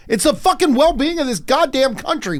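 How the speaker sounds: mock outrage. Mock outrage over country.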